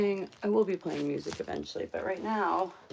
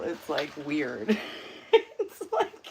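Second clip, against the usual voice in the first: laughing; slower than usual. Real, not acted. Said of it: "My Japanese accent is terrible".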